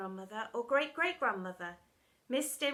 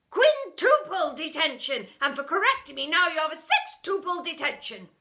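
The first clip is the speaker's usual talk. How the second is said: gloating teacher; 5 semitones higher, 11 dB louder than usual. Acted. Miss Seeth punishing pupil, gloating. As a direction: relish the bigger number.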